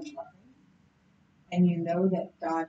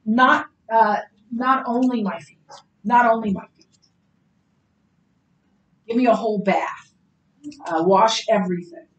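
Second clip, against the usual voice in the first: rash demand; 8 dB louder than usual. Retold or imitated. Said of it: "And then he said".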